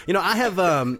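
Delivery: ordinary talk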